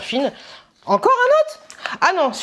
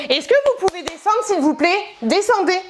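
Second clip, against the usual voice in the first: pleading request; 7 semitones higher than usual. Real, not acted. Telling kids to get down.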